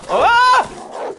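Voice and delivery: very high, loud yelp of pain; sudden scream when bitten. Real, not acted. Bitten by a wolf.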